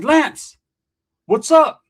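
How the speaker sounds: loud, high, urgent hail; calling out. Retold or imitated. Storyteller hails friend at school, loud urgent call. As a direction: hail a friend and demand answers.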